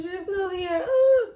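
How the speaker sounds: high mock wail; imitated crying. Owner finding the ferret gone, imitated crying wail. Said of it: "crying again".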